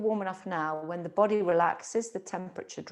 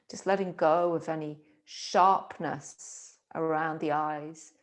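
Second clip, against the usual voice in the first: guided meditation. Acted. Teacher guiding awareness along spine and breath, calm meditation. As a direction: guide them gently into stillness, phrase by phrase.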